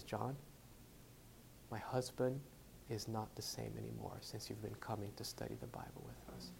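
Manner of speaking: soft, low retold voice of a wife; hushed, understated quote; quiet retold confession. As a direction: tell it softly, as she said it. Retold wife speaking as room quiets.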